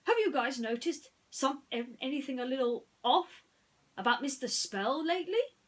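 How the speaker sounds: hint of a worried toy pig; lightly voiced Ham. Ham asks friends about Mister Spell, lightly voiced. Ham's voice.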